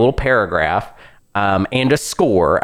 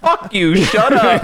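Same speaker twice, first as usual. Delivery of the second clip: mock brush-off; 10 semitones higher, slower than usual. Acted brush-off of game characters.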